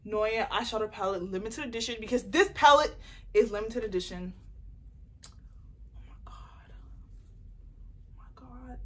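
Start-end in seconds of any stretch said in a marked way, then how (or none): 5.3-8.8 awed whisper. Real, not acted. Awed at the palette.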